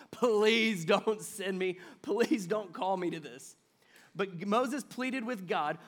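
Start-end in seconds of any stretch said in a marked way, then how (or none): 0.1-3.6 drawn-out, loud plea; begging. Acted. Preacher as Moses begging God not to send him, pleading. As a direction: plead hard, stretching out the please. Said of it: "He's just straight out begging".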